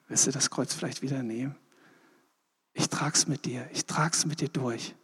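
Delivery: soft, hushed and reassuring, as if leaning close; gentle whisper. Retold or imitated. Jesus comforting a weary believer, hushed whisper. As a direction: breathe it like a comforting secret.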